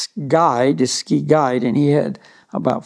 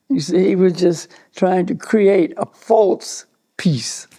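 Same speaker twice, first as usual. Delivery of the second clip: ordinary delivery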